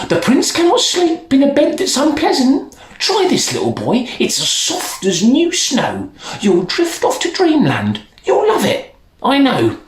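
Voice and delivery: high, coaxing peasant. Acted. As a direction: sweet-talk the child into bed. Peasant voiced in story.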